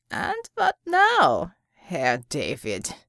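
put-on slow sneering question in a German accent; mocking German villain. A narrator's reading. Villain taunting the woman after healing, slow and German. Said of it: "he asked".

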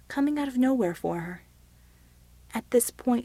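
ordinary delivery, nothing marked

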